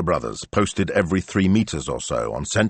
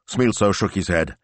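plain reading voice

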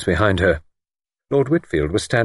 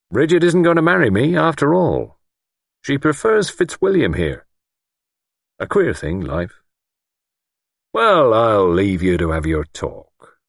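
jilted, self-important lord; 5 semitones higher, 3 dB louder than usual. A narrator's reading. Lord announces broken engagement, pointed.

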